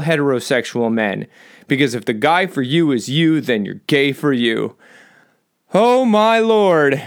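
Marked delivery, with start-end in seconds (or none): 5.7-7.0 mock amazement. Real, not acted. Mock amazement at own joke.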